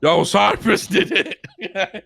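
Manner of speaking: high, drawn-out mock call through laughter; slow, giggling impression of someone calling out; laughing imitation. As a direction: crack up while mimicking the callout. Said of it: "I'm sorry for laughing".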